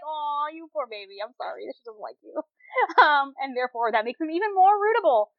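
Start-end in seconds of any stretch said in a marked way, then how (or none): 0.0-2.3 mock pity. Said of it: "so it's very much like".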